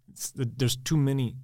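normal talking voice

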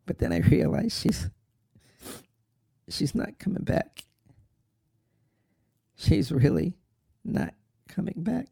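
high, halting, voice giving out; tearful. Acted. Son realizing Mom is gone, breaking into tears.

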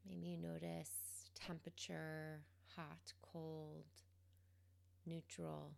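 calm, quiet guidance, leaving space between phrases; guided meditation. Real, not acted. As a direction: gently guide them into the present moment, unhurried.

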